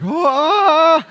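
high, loud held shriek; mock scream. Preacher imitates people freaked out by bugs, mock scream. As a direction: shriek as if spotting a bug. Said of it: "Some of you are like".

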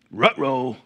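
a light Scooby-style ruh-roh; cartoon uh-oh. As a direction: toss off a cartoon dog's uh-oh. Cartoon uh-oh after the joke.

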